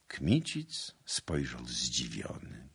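plain reading voice

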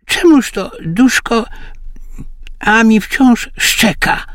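put-on a high old man puzzling at his wife; old husband. A narrator's reading. Old husband asks his wife why the dog barks, high old voice. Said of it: "rzekł staruszek".

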